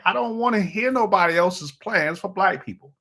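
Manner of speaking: high-pitched, exasperated declaration; worked-up. Real, not acted. Host on his soapbox about political plans, worked-up. Worked up, then repeats it settled.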